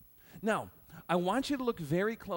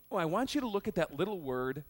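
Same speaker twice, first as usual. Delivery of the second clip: usual speaking voice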